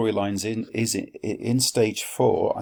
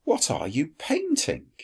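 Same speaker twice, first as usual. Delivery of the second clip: higher question voice; 6 semitones higher than usual. Acted. Quintus asks artist.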